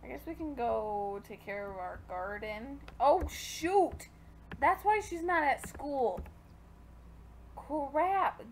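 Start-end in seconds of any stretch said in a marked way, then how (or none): 3.0-8.5 sudden alarmed outburst; oh no, caught off guard. Real, not acted. Realizing mistake in game.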